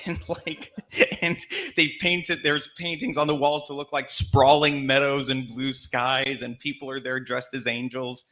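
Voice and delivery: ordinary talk, nothing marked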